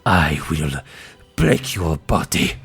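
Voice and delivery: low, deliberate vengeful threat; menacing villain. Acted. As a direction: intone it low and drawn out, relishing the doom. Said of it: "she goes".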